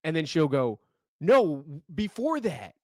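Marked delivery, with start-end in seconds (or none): 1.2-2.7 mock wife. Wife voiced, mishearing.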